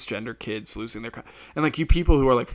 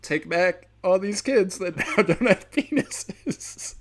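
laughing mock handover; 6 semitones higher, 5 dB louder, slower than usual. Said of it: "And then I'll be like".

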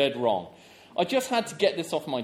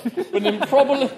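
laughing; 8 semitones higher, 6 dB louder than usual. Real, not acted. Reading the letter, cracking up.

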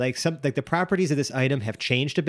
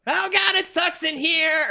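very high, squeaky complaint; miniature trapped man; slower than usual. Pretend. Felix complaining from inside the gem, tiny squeaky wail. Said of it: "miniature Felix".

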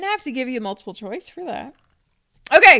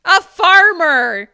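emphatic reveal; 6 semitones higher, 12 dB louder, slower than usual. Real, not acted. Announcing the quiz answer.

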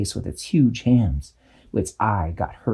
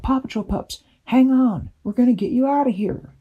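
high, fast rallying fairy voice; fairies rallying pups; 14 semitones higher than usual. A narrator's reading. Fairies telling the pups to hang on, rallying. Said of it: "and said".

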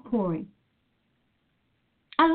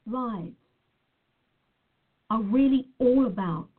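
ordinary delivery

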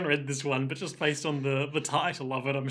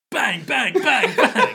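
high, loud mock gunfire; playful pretend shooting; imitated gunshots; 8 semitones higher, 7 dB louder, slower than usual. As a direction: fire off shouted bangs, breaking into laughter. Pretend revolver shots in the skit.

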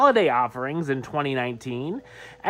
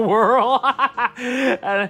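laughing; 8 semitones higher, 4 dB louder than usual. Real, not acted. Laughing at the post.

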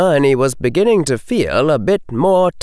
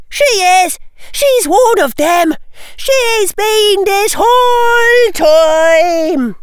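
put-on loud, very high girl's squeal; high excited shouting; 5 dB louder, slower than usual. Tracy insisting Susan is one of them, high shouting. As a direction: shout it, stretching the last phrase out long. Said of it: "her voice so high it cracked".

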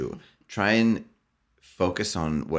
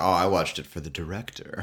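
snooty film buff; 2 semitones higher than usual. Retold or imitated. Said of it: "somebody's like".

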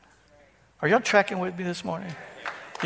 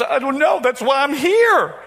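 higher-pitched imitation of the church lady; imitating the lady; 7 semitones higher, 4 dB louder than usual. Pastor retelling a counseling visit, voicing the lady higher. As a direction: play her voice higher, plaintive and helpless.

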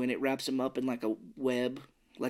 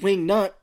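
Wing nut punched loud and higher as a punchline; punchline with pause; 6 semitones higher, 9 dB louder than usual. Shown as a demonstration. Showing how Gribble milks his joke, punched loud. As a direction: hit Wing nut like waiting for applause. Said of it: "I would just love him to pause".

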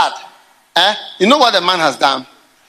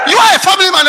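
shouted repeat; 10 semitones higher, 5 dB louder, much faster than usual. Acted.

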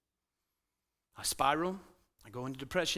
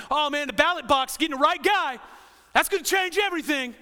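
higher and louder, mock eager voter; mock excitement; 6 semitones higher, 6 dB louder than usual. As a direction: ham up the election fever. Mocking voters obsessed with politics.

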